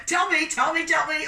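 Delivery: high, loud eager call to the crowd; urging shout. Real, not acted. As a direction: egg the audience on loudly. Urging audience to answer.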